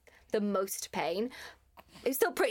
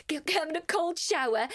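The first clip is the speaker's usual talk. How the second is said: ordinary delivery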